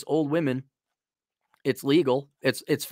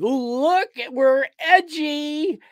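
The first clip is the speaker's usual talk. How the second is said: high, loud, hammed-up preening brand voice; mock corporate voice; 11 semitones higher, 6 dB louder, slower than usual. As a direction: lampoon a company bragging how edgy it is. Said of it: "Same thing Playboy's doing".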